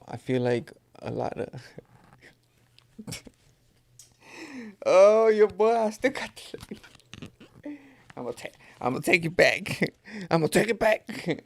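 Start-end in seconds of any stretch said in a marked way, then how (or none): 4.8-11.5 laughing. Real, not acted. Laughing after old-man remark.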